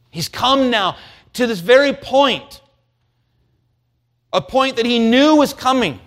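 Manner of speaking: fervent pulpit crescendo; preaching at full force. Acted. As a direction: preach it out, rising to the peak. Preaching climax.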